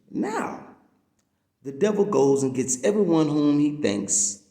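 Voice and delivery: ordinary delivery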